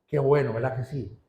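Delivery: a pleased, settled little exclamation; satisfied sigh. Retold or imitated. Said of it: "Usted se siente satisfecho y dice".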